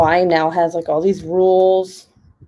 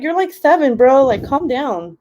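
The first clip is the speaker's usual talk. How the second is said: exasperated; 6 semitones higher than usual. Retold or imitated. Mom scolding son.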